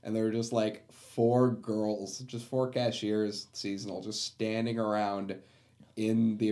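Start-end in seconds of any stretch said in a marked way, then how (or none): none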